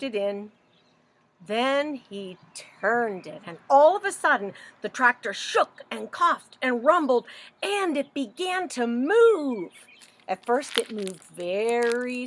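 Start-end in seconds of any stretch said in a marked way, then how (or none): 3.4-9.7 louder, rising excitement; excited. A narrator's reading. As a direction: build the sudden start. Tractor starts suddenly.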